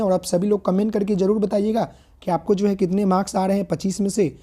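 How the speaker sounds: normal talking voice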